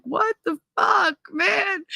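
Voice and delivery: put-on high, slow, stunned boy; boy pinned to the van, panicking; panicked kid. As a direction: gasp it out in terror. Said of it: "he was just like".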